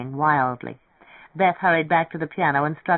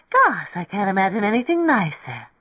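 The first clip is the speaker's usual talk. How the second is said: gushing; 4 semitones higher than usual. A narrator's reading. Beth gushes over the idea of leaving together, gushing. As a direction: gush with big rises and falls.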